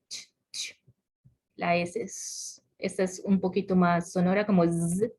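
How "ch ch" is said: ch breathed out short and unvoiced, twice; unvoiced ch demo. Shown as a demonstration. Teacher demonstrating the ch sound to the class. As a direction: hiss the ch sound with no voice. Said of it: "La S, la Z".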